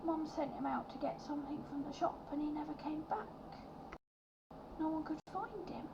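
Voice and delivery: put-on high, soft small-child voice; sad little boy. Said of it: "shake his head and go".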